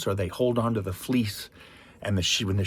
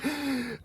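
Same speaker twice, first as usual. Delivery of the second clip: mock gasp; 5 dB quieter than usual. Gasp before mock boast.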